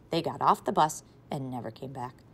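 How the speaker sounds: ordinary delivery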